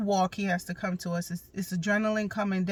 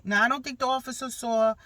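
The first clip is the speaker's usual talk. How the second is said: usual speaking voice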